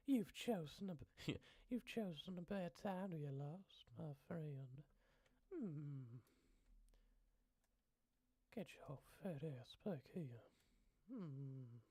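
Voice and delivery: high, put-on woman's voice; sing-song high impression of her; sounding like her. Imitating a woman threatening a lost traveller, high. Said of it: "I sound like her".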